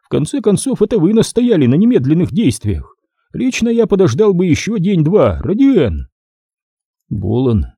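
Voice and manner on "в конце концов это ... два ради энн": higher, mocking older major; a sly, indulgent Stone; Stone's silky voice. A narrator's reading. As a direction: voice Stone teasing his partner.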